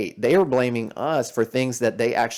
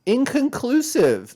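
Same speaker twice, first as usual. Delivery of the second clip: mocking imitation; 14 semitones higher, 5 dB louder, slower than usual. Mocking the professor's clipped answer.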